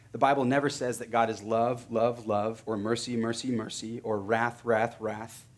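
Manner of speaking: ordinary delivery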